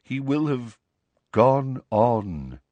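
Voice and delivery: measured pronouncement with a pause; solemn. A narrator's reading. Nick tells Harry of Sirius's passing, solemn. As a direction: pause before the final words.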